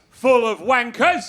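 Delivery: loud, high mock heckle, drawn out; jeering heckler yell; heckler's yell. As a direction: shout it like a rowdy crowd member. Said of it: "Guy in the crowd goes".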